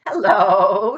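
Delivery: put-on loud, high old ewe; bold, nosy old sheep. A narrator's reading.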